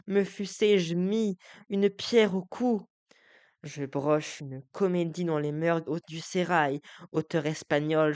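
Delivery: Figaro trahi, amer et emporté; déclame comme un homme blessé; monologue déclamé. Acted. (Figaro déclame, amer et emporté)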